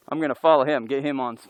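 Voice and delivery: ordinary delivery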